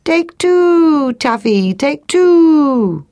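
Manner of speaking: high, long cooing call, falling away; silly turtle dove cry. Acted. Turtle dove ignoring the lesson, silly high cooing cry. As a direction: coo it like a dove, stretching each "two". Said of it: "Still the turtle dove cried".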